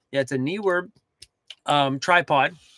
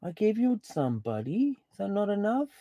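talking to the dog; 5 semitones higher, 6 dB quieter than usual. Real, not acted.

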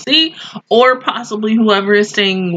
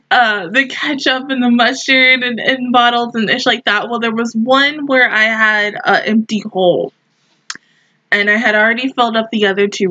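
ordinary delivery

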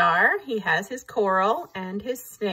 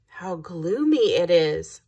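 plain reading voice

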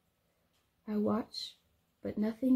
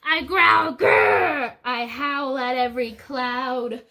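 louder, slow mock growl; mad and loud; 3 semitones higher, 9 dB louder, slower than usual. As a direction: growl it like an angry dinosaur. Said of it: "mad and loud".

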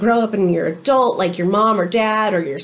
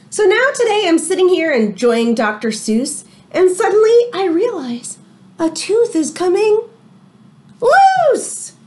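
higher read-aloud with a big rise at the end; story reading; 7 semitones higher, 4 dB louder than usual. A narrator's reading. Teacher reading a storybook to her class, rising to a high finish. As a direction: build up to the reveal.

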